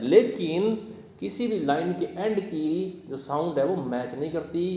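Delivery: ordinary delivery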